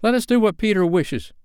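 high-pitched obedient cry; eager cry. A narrator's reading. Simple boys obeying Peter's order, high eager cry. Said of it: "cried the simple boys".

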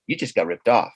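whisper-like voice of suspicion from within; inner voice. Retold or imitated. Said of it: "something in my head said".

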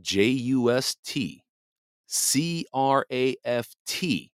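letters spelled out one by one, slow; slow, careful letter-by-letter spelling; spelling out slowly. Shown as a demonstration. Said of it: "It's spelled".

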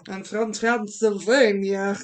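put-on slow, loud Transylvanian accent; hammed-up Transylvanian vampire accent; Dracula-film Transylvanian accent. Showing friend the Curse of Strahd vibe, Transylvanian Dracula accent. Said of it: "Dracula film level of, like".

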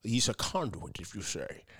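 low, soft leprechaun-like brogue; Sean Connery voice. Acted. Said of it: "it's Sean Connery from the grave".

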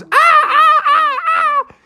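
repeated very high yelps; high-pitched scream. A narrator's reading. Froggy's toes are chomped, high panicked screams. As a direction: shriek in cartoon panic.

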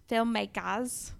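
"Filmmakers" slow in a mock Australian accent, ending "-kahz"; playful Aussie "Filmmakers". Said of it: "That's my Australian accent".

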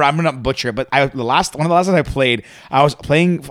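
usual speaking voice

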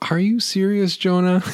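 high, disbelieving cry at Jonah; mock outrage, then a laugh; incredulous. Real, not acted. Preacher exclaiming at Jonah in disbelief, high and incredulous.

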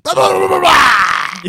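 loud, high crazed yelling; intense shout. Shown as a demonstration. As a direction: burst into manic shouting. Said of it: "bring that intensity to it".

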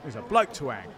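ordinary delivery

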